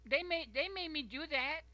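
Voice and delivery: over-the-top mock mother insisting it was them; mocking excuse voice. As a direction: whine like a guilty mom blaming everyone else. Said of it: "Like, some".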